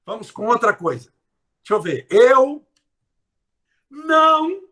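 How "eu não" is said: não high and loud; stress on não. Shown as a demonstration. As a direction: pitch the não up and punch it. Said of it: "olha a palavra". (não bem alto e forte)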